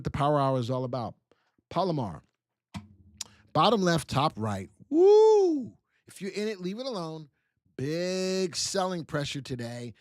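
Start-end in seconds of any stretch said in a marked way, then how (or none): 4.9-5.7 high, loud whoop of delight; whoo cheer. Real, not acted. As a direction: let out a joyful whoop. Cheer over the chart.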